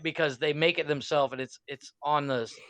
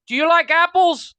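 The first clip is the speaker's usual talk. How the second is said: imitating a yell, much higher and louder; hammed-up Good Will Hunting shout through the glass; mock shout; 12 semitones higher, 10 dB louder than usual. Retelling the bar scene to friend, shouting mock movie line. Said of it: "He's like".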